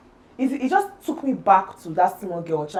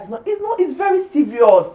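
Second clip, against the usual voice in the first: high, loud, exasperated outburst; heated protest; 9 semitones higher, 8 dB louder than usual. Retold or imitated. Woman arguing back at dismissive people, heated protest. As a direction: protest with rising pitch and volume. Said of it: "and then I get heated".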